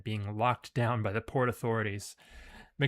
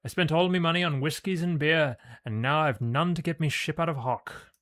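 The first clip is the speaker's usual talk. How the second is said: put-on drunken Irish lament, higher and louder; loud, higher Irish brogue; 5 semitones higher, 6 dB louder than usual. Broke sailor moaning to the player about his ship, in a loud Irish brogue. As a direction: belt out his money woes in a brogue.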